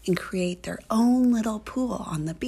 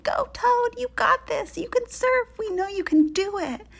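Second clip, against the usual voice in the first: high, loud rallying cheer; cheering him on; 12 semitones higher, 5 dB louder than usual. A narrator's reading. Girls cheer the toad on from the beach, excited high shouts. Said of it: "they were cheering him on".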